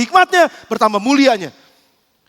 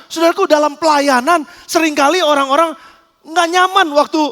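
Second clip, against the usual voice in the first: ordinary talk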